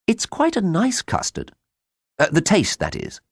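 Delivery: high, light character voice; mild Tancred voice. A narrator's reading. Tancred tries to console the ruined farmer, high mild voice. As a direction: offer it as a hesitant consolation.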